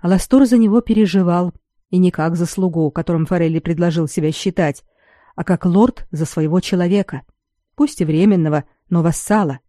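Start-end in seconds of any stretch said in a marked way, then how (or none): none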